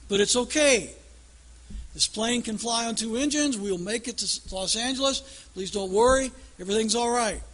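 growing mock captain anxiety; captain's announcement. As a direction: reassure the passengers a bit too hard.

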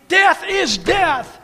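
slow, high, booming declaration; thundering preacher. Real, not acted. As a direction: hammer it down slowly, full force.

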